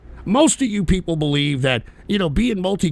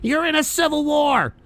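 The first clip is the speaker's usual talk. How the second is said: mock Tim Pool, pitched higher; mocking imitation; 8 semitones higher than usual. Mocking a commentator's civil war talk, sneering imitation. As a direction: parrot him with a sneer. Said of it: "this idiot has said".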